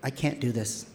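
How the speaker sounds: soft, hushed thought; soft panicked inner voice; inner voice, quiet. Retold or imitated. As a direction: whisper it to yourself in panic. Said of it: "I'm thinking to myself".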